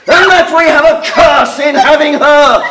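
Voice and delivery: high, loud furious outburst; angry shout. Acted. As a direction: let the curse explode. Father rages at daughter.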